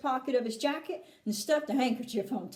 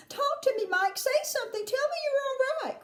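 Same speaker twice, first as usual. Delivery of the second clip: high-pitched pleading mother; worried cry; 12 semitones higher than usual. A narrator's reading. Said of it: "cried Missus T V".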